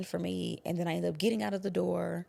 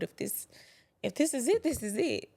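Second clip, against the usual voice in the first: higher, louder, strained; desperate prayer; 7 semitones higher, 5 dB louder than usual. Retold or imitated. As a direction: voice rising in panic, then settling. Praying with gun at head.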